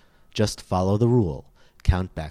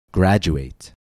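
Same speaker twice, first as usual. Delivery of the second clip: Graduate with a loud GRAD; louder model word Graduate, stressed on GRAD; stress before suffix; 5 dB louder than usual. Shown as a demonstration. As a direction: punch the first syllable of Graduate. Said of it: "the stress always falls two syllables before".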